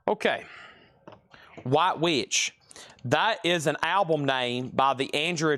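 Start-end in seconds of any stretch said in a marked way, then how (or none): none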